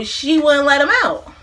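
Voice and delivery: normal talking voice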